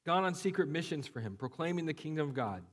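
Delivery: usual speaking voice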